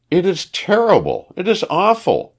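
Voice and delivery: high-pitched, anguished outcry; crying out. A narrator's reading. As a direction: exclaim it high, falling hard on each phrase. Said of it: "she cried".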